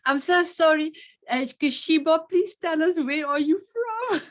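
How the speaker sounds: high, loud, flustered plea; flustered apology. Real, not acted. Host asks guest where she is from, flustered. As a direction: apologise loudly and pitch it up, asking for help.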